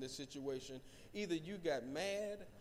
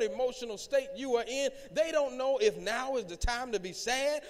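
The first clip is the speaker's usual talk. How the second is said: loud, raised, driving sermon voice; full-force preaching; 4 semitones higher, 6 dB louder than usual. Real, not acted. Pastor describing mood swings to his congregation, full-force preaching. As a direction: preach it hard and high to the room.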